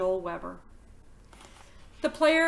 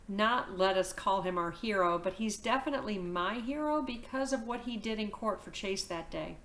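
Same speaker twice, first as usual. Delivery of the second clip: ordinary delivery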